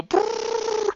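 high rolled brrrr; high buzzing whirr; imitated launch noise. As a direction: trill a spaceship noise. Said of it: "and you go".

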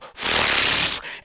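loud breathy whoosh; loud launch whoosh; rocket launch noise. Acted. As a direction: imitate a rocket launching with a rush of air. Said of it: "you're launching a rocket, you go".

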